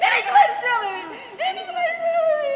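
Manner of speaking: high, loud, breaking into sobs; crying loudly, sobbing through the words; sobbing wail. Acted. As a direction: wail it out in despair.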